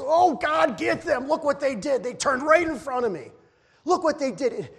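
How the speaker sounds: hammed-up angry driver begging God; mock road rage. Preacher mimicking himself raging at Milwaukee drivers, exasperated. Impatient driver praying for judgment.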